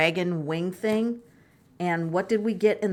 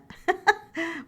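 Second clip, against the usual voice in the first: light laugh; 9 semitones higher than usual. Real, not acted. Signing off the video.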